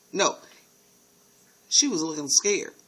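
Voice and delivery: usual speaking voice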